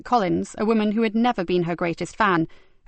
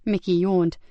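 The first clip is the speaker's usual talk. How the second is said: usual speaking voice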